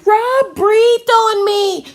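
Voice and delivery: put-on a stampede of complaining children yelling over each other; clamoring children. A narrator's reading. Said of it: "the stampede started".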